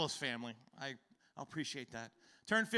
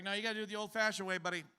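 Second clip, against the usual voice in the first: rushed doctor; 4 semitones higher, much faster than usual. Retold or imitated. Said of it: "he's like".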